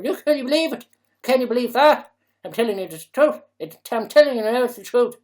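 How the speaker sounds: put-on loud, high, incredulous Dublin accent. Mock Dubliner insisting the looting is true, loud Dublin accent. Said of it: "put Dublin accent into".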